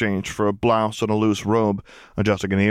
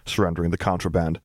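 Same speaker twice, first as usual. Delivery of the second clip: plain reading voice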